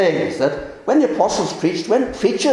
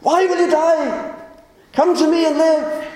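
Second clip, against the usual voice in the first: pleading; 8 semitones higher than usual. Acted. Preacher voicing God pleading with sinners, higher urgent plea. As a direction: beg the listener to turn and live. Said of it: "is pleading with people".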